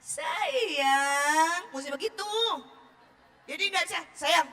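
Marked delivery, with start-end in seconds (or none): 0.0-1.7 long drawn-out, sing-song SAYANG; sweet, controlled intonation. Shown as a demonstration. Mother addressing an auditory child, sweetly drawn-out. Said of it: "mesti mengendalikan intonasi ngomongnya". 4.2-4.5 short, abrupt "Sayang"; angry endearment. Shown as a demonstration. Mother angry at her child, curt endearment. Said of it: "dia tahu nih kita lagi marah".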